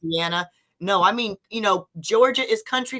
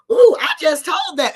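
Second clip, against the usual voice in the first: higher, louder gossip; loud friend quote; 7 semitones higher, 5 dB louder than usual. Retold or imitated. Bank teller friend starting her story, louder. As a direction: pop it like your loud friend. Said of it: "She was like".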